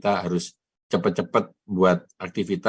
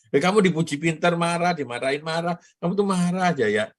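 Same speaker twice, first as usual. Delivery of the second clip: raised, mock-scolding tease; playful teasing; 9 semitones higher than usual. Real, not acted. Host ribbing the young colleague on the call, high playful mock-scolding. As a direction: rib him lightly for sulking.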